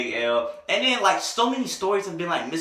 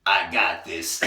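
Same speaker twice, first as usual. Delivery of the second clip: much lower, imitated cool-guy voice; cool, smooth brother; 10 semitones lower, much slower than usual. Boyfriend steps in to defuse it, deep smooth assurance. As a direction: drop low, totally unbothered. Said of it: "like, a cool, smooth brother".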